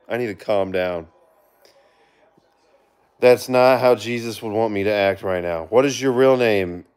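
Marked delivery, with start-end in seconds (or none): 0.1-1.1 softer, settling back down; "I need to calm down" said under his breath to cool off. Real, not acted.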